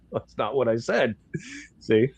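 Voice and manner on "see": ordinary talk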